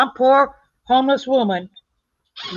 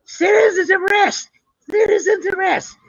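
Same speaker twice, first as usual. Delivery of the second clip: imitated yelling; 9 semitones higher than usual. Retelling a woman demanding a citizen's arrest, high mock yell. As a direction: mimic someone yelling out, pitched way up. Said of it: "And she's yelling".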